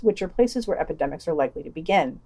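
ordinary talk, nothing marked

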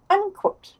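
ordinary delivery

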